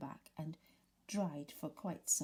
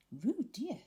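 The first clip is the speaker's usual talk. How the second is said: reproachful; 7 semitones higher than usual. A narrator's reading. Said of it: "said Kanga reproachfully".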